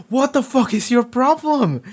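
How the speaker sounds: high mock-exasperated Rex voice; hammed-up outraged teammate; imitating Rex. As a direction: squeak out an annoyed mock yell. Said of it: "Rex is like".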